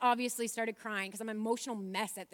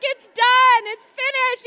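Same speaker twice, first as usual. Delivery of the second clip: emotional cry; 13 semitones higher, 18 dB louder, slower than usual. Retold or imitated. Emotional announcement.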